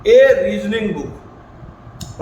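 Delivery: very slow, each word set apart; slow model answer. Shown as a demonstration. Teacher modelling the correct article for students, slow. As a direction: spell out ए reasoning book slowly for the class. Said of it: "उसके पहले क्या जाएगा?".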